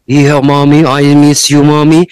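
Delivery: a grown son missing his mom; son's voice. Retold or imitated.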